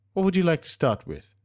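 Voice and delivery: bright service voice taking orders; waitress greeting the couple at the table. A narrator's reading.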